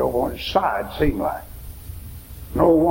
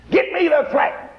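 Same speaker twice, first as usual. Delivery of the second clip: hollering woman; 12 semitones higher than usual. Retold or imitated. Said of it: "she hollered and screamed".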